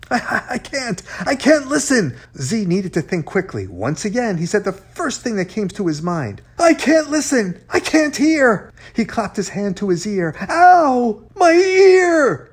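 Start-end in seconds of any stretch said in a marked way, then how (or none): 0.1-2.1 high, stammering protest; flustered panic. A narrator's reading. Panicking, stuck for an excuse. 6.6-8.7 high, loud insistence; panicked blurting. A narrator's reading. 10.5-12.5 very high, loud wail; pained yelp. A narrator's reading. Feigning sudden ear pain.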